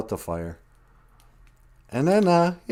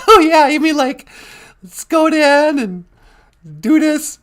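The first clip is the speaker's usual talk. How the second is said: much higher, louder squeaky pet voice; pet's voice; 10 dB louder than usual. Pretend. Host greeting viewers on behalf of his pet, much higher cutesy voice.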